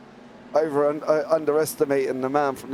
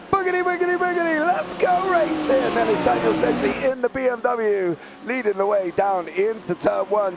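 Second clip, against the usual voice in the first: high, excited race-start call, stretched at the green flag; live play-by-play; 7 semitones higher than usual. Real, not acted.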